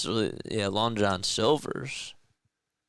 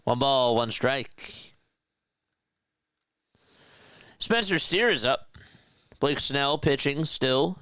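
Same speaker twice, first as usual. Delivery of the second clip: play-by-play call. Acted. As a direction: call the count like a TV broadcaster. Live play-by-play of his game.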